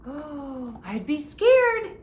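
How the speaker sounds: hammed-up fright; mock fright. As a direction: play scared for the kids.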